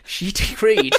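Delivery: booming theatrical proclamation; hammy stage actor. Pretend. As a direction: declaim it like a ham actor. Repeats with grander delivery.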